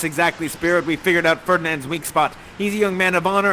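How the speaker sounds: ordinary talk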